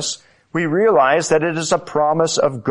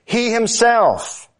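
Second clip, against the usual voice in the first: HIMSELF rising much higher, drawn out slowly; emphatic he himself; 11 semitones higher, slower than usual. Shown as a demonstration. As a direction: lean hard on "himself", stretching it high. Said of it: "but it's emphatic".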